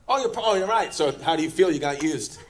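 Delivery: ordinary talk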